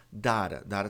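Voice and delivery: "data" as DAH-ra, open A and tapped T; wrong open A. Shown as a demonstration. Teacher showing a common mistake, DAH-ra. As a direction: say "data" with a wide "a", dara. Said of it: "dara tá errado".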